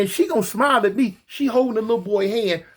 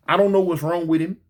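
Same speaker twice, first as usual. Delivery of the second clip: ordinary delivery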